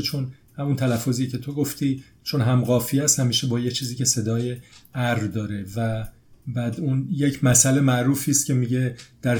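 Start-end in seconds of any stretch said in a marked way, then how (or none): none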